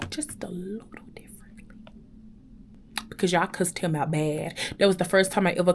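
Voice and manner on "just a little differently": breathy whispered aside, almost unvoiced. Real, not acted. Whispered aside to viewers, admitting she could have done better. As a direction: drop to a whisper on the last words.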